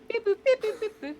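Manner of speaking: high-pitched electronic beep-boop noises; imitated console beeps. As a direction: voice the buttons as high little beeps. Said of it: "It goes".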